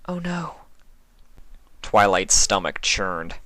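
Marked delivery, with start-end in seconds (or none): 0.1-0.6 soft breathy realization; whispered dread. A narrator's reading. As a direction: whisper it in horror. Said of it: "her voice shrank".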